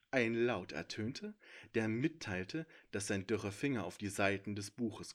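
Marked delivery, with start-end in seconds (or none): none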